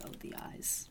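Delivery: hushed mock whisper; whispered imitation of people noticing the eyes; whispered aside. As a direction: breathe it like a knowing secret. People noting her Middle Eastern eyes.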